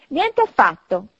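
interrupting. A narrator's reading. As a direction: cut in firmly as the wife. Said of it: "interruppe la moglie".

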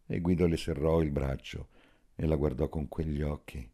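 usual speaking voice

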